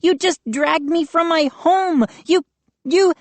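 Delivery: high angry sputtering shout; outraged. A narrator's reading. Mindy yelling at the gnomes, sputtering with fury. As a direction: rage until words fail.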